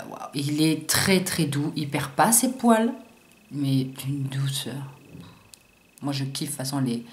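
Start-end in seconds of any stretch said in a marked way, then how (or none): 0.1-0.3 whispered wow. Real, not acted. Amazed by the brush softness. 3.5-4.7 slow awed whisper. Real, not acted. Marvelling at the brush softness.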